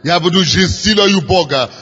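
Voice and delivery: usual speaking voice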